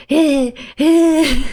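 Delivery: whispered breathless panting; out of breath. Acted. Child who chased the bus, breathless panting. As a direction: pant it, winded from running. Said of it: "She's all out of breath".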